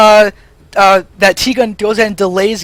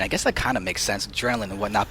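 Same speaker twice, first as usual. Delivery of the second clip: normal talking voice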